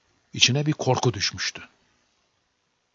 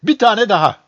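raised, louder exclamation; crying out; 6 semitones higher, 5 dB louder than usual. A narrator's reading. Boy spotting one more tiny person, crying out. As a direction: call it out high and loud, in a storyteller's way. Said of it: "diye haykırdı".

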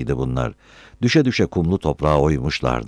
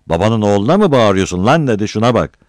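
raised, loud indignant challenge; angry retort; 5 semitones higher, 6 dB louder than usual. A narrator's reading. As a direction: confront him for yelling. Enraged retort in acted quarrel.

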